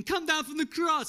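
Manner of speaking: high, loud taunt; mocking jeer. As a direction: jeer at the man on the cross. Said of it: "They were mocking him".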